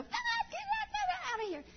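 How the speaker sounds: high squealing panic at a roach; women shrieking at a roach, high panic; mock scream. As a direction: shriek it out, hammed-up. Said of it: "all of us would probably scream".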